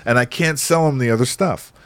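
mock-indignant caller imitation; exasperated shop owner. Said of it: "He's like".

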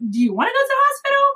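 very high, rapid retold question; quick, high-pitched mock disbelief; pointed question. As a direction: imitate a midwife's incredulous challenge. Midwife challenges her about hospital.

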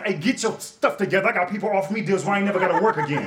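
high, eager and quick news to the wife; excited announcement. Retold or imitated. Telling his wife about the deal, high and eager. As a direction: brag like you hit the jackpot. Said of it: "told my wife".

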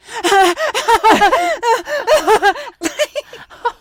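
high, loud wailing laugh-cry. Retold or imitated. Retelling her movie-theater breakdown, loud wailing laugh-cry. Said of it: "I started crying like".

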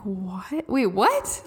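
retold baffled double take; confused. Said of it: "I started to get so confused".

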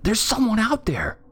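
high, urgent sister voice; alarmed, high-pitched protest; snapping. A narrator's reading. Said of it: "she snapped".

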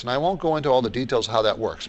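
ordinary delivery

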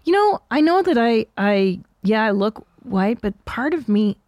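ordinary talk

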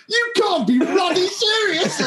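high posh shout of disbelief. Retold or imitated. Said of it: "got a posh voice".

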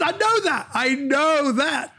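high, drawn-out gushing; mock excited fan. To the composer, a high, slow, gushing fan voice. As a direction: gush like a starstruck fan. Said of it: "I said".

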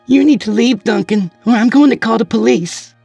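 high, loud frantic order; frantic warning. Acted. Wife threatens husband with police, frantic high voice. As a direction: order him out in a panic. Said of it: "Lillian barged out of her room frantically".

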